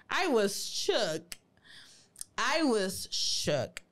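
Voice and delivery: very slow, drawn-out words; dramatic shock. Real, not acted. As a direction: let each word land, stunned and slow. Dramatically recounting own shock. (conmoción dramática, muy lenta y alargada)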